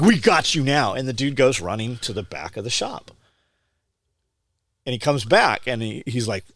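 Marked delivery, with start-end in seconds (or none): 0.0-0.9 higher and louder retold gloat; mock triumphant cop. Agent tells the speaker he's caught, triumphant and louder. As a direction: crow it like the agent thinks he's won.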